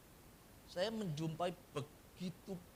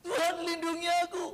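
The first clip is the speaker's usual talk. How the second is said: high, louder begging plea; pleading believer; 11 semitones higher, 8 dB louder, faster than usual. Retold or imitated. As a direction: cry out to God for mercy. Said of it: "pleading prayer". (jemaat memohon kepada Tuhan dengan suara tinggi dan keras)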